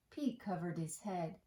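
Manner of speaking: plain reading voice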